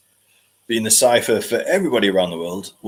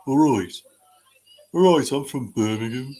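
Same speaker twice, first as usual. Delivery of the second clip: all right in a broad Brummie accent, pitched up; 4 semitones higher than usual. Shown as a demonstration. Demonstrating the Brummie accent for chat: broad Brummie greeting. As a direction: play a Brummie greeting a mate. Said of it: "a Brummie accent".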